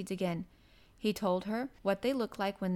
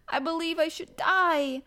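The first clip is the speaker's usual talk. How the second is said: high, loud, fierce outburst; 7 semitones higher, 8 dB louder than usual. A narrator's reading. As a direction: hit it fiercely, raising pitch and volume. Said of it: "said that last sentence quite fiercely".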